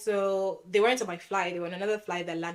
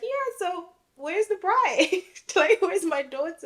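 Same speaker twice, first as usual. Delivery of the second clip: higher, slow, a hint of a puzzled mum, laughing; mum asking; 11 semitones higher, 5 dB louder than usual. Mum asking the husband where the bride is, puzzled. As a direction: lightly voice a puzzled mother.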